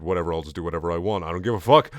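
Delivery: usual speaking voice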